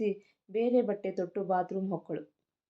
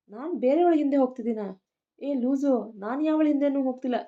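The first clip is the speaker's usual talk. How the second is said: heated denial; 5 semitones higher, 6 dB louder than usual. Acted. Husband denies accusation heatedly.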